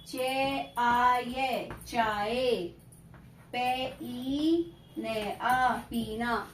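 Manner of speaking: slow sing-song syllable spelling; sing-song spelling drill; drawn-out dictation. Acted. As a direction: stretch each syllable like a tuition teacher dictating.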